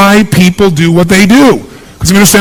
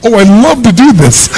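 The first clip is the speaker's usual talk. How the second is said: mock delight; 4 semitones higher than usual. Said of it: "How many wake up in the morning".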